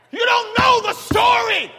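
'You don't know the story' shouted loud and high; preacher's shouted refrain; hollered refrain. Acted. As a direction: holler 'know' and let it fall. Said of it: "why I holler when I preach".

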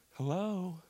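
put-on hushed, tender hello to a child; quiet dad answering softly; soft answering call. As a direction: gently echo your little son, re-enacted. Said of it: "I said back to him".

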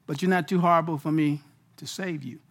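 a quiet voice of mercy; gentle divine reassurance. Acted. God softly telling the sinner he can still be saved, tender.

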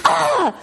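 high, sharp yelp of despair; high cry of dismay. Retold or imitated. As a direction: yelp like the last straw. Dismay at erased camera.

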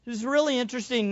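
normal talking voice

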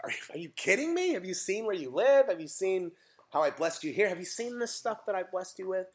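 high-pitched, exasperated disbelief; mock incredulity. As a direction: play God baffled by ungrateful children. God incredulous at ungrateful people, acted.

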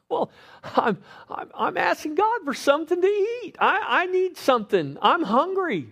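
high, pleading whine, voice climbing; a pitiful hungry man begging God; hammed-up whimpering husband crying out for food; whiny high plea. Whining husband begs God.

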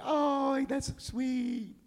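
high, drawn-out mock swoon; gushing coo. Preacher mimics onlookers cooing at a couple in love, gushing.

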